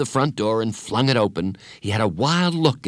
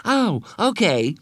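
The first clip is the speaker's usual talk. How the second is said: high, drawn-out happy acceptance; slow, cheerful boy's voice; 10 semitones higher, slower than usual. Acted. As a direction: accept it happily, pitched up. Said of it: "he said happily".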